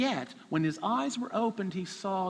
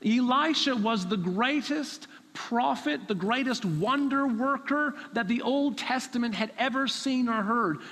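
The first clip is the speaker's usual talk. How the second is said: ordinary talk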